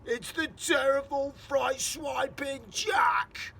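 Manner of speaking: slow, loud, high panicked wailing; terrified giant. Acted. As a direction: howl out each horror.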